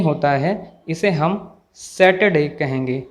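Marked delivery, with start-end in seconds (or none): 1.8-2.5 सैटरडे with no r sound; silent r. Shown as a demonstration. Teacher introducing the correct word, r silent. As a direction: drop the r in सैटरडे. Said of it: "आर का कोई sound नहीं".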